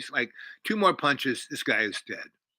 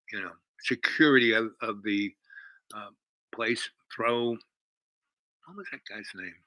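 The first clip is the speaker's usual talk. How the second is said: ordinary delivery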